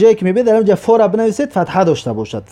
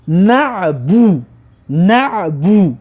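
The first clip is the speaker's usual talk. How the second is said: very slow, drawn-out "نه بو"; syllable sounding-out; much slower than usual. Shown as a demonstration. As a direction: stretch each syllable for learners to copy. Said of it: "دمه داشته باشد".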